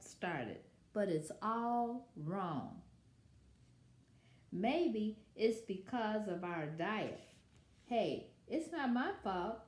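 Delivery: lively read-aloud as the wolf telling his side; story narration. A narrator's reading. Wolf narrator telling children his side, storytelling voice. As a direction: read it like a sly storyteller to kids. Reads picture book as wolf.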